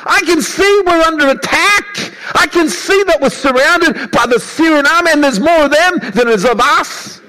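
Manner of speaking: high, frantic protest; panicked servant. Retold or imitated. Servant protesting to Elisha that they are surrounded, high panicked voice. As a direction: plead in a high, scared voice. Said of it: "the servant is saying".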